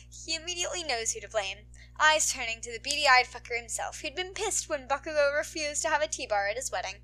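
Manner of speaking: plain reading voice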